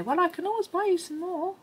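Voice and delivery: airy, offhand mimicry of a spendy husband; pitched well above her own voice, imitating husband; husband impression, high-pitched. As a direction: quote husband in a high, lifted voice. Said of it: "my husband would say".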